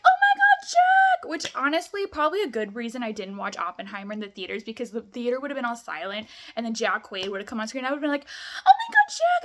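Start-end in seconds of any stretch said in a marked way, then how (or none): 0.0-1.3 high, loud excited shriek at the screen; excited shout. Retold or imitated. Spotting actor on screen. 8.7-9.4 high, loud fan shriek; excited shout. Retold or imitated. Imagined outburst in silent theater.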